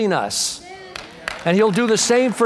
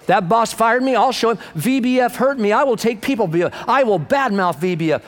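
ordinary talk, nothing marked

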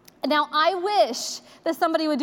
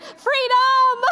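much higher, louder whoop of relief; jubilant freedom cry; 11 semitones higher, 5 dB louder than usual. Retold or imitated. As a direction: burst out like school's finally out for mom. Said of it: "and I went".

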